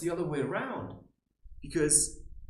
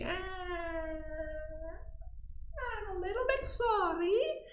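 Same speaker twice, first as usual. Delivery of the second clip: high, pitched-up mock sorry; mock apology; slower than usual. Mocking blamers in the mirror with a high fake sorry. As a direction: fake a tiny apology, squeaky. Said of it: "I'm gonna say".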